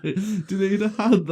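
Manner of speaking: hammed-up high dad voice; high-voiced mimicry. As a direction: squeak the offer, barely holding in laughter. Dad offering help, teller laughing.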